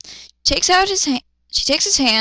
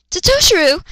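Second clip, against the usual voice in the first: high, loud cry of his name; alarmed shout of the name; yell; 6 semitones higher, 5 dB louder than usual. Acted. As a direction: call out in alarm as he falls. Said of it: "she yelled".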